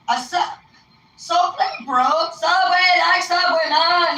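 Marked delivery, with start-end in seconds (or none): 1.2-4.2 high, loud mock argument; exasperated back-and-forth. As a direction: act out a misunderstanding boiling over. Escalating retold argument over name.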